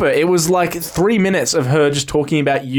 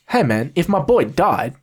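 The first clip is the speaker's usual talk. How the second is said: usual speaking voice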